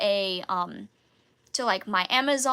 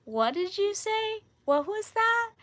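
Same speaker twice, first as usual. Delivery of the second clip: higher, puzzled fast-food worker voice; confused drive-thru worker; 9 semitones higher than usual. Retold or imitated. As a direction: play the baffled guy at the window, pitched up.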